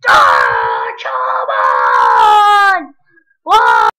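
very loud, drawn-out yell; frustrated scream. Real, not acted. Gamer losing at a hard level, frustrated scream. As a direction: scream in real exasperation.

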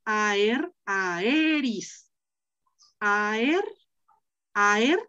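"aer" said slowly, the voice rising on the final "er"; Latin pronunciation. Shown as a demonstration. Teacher modelling the Latin entry for her student, "aer" drawn out carefully. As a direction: say "aer" and "aeris" carefully, leaning on the "e". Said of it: "acuérdate que ae es diptongo".

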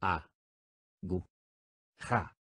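"A. Gu. Ja." each syllable spoken apart with a pause. Shown as a demonstration. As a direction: split "Aguja" into A, Gu, Ja with clear gaps. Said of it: "dila silábicamente conmigo". (separando sílabas: "A. Gu. Ja.")

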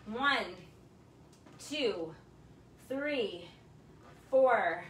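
loud, punchy rep counting; energetic fitness coach counting down; workout count. Acted. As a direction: drive the class through each rep. Coach counting reps aloud.

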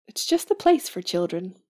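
normal talking voice

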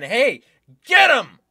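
loud, high-pitched order to attack; acted shouted command. Retelling an official urging fighters on, acted shout. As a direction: shout it like setting someone on a target. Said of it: "pointing at him and saying".

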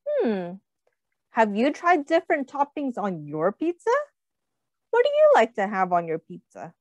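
usual speaking voice

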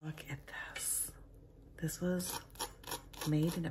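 soft hushed whisper. Real, not acted. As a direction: whisper so no one wakes. Whispering in the Airbnb.

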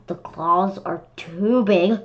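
plain reading voice

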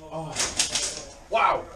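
ordinary talk

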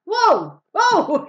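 high, loud startled cry; very loud, high astonished whoa. Acted. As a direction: act astonished. Acting startled by puppet.

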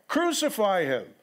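mild shout read from scripture; crowd crying out harder. A narrator's reading. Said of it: "cried out all the more".

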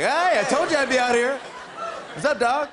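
mock excited calling out; acted Biden shout-out. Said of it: "He was like".

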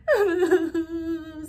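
loud, hammed-up wailing sob; high stuttering fake sobs; mock sobbing. A narrator's reading. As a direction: blubber loudly in cartoon terror. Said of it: "sobbed the dogs".

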